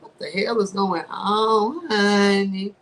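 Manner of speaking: slow, drawn-out what-the-hell; mock disbelief. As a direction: stretch it out in lazy disbelief. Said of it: "I was like".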